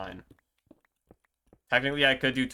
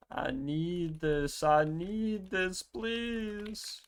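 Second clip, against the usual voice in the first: slow, high, drawn-out pleading; whiny begging; 7 semitones higher, 5 dB quieter, much slower than usual. Pretend. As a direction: beg like a desperate child. Desperate begging to villager.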